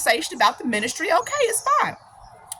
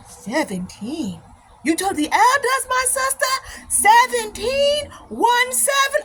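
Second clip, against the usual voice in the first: incredulous imitated African accent, rising very high; slow African-accented disbelief, jumping high; African brother's accent; 11 semitones higher, slower than usual. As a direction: mimic a scandalized African brother. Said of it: "this brother from Africa".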